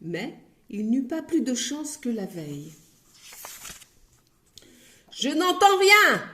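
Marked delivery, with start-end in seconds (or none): 5.1-6.3 much higher, loud thunder. Acted. Grocer thundering at the timid customer. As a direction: boom it out. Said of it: "tonna l'épicier".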